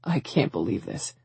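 low, soft, falling disbelief; quiet disbelief. A narrator's reading. Sam to Elizabeth on learning of cameras, quiet disbelief. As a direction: say it low, stunned under your breath.

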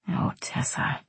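soft, whispered sigh of her name; near whisper. A narrator's reading. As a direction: breathe it out very softly. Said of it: "he said my name softly".

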